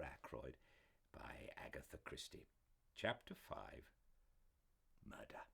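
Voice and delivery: fireside storytelling voice; story narration. A narrator's reading. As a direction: read it like a cozy mystery audiobook. Fireside reading of a novel.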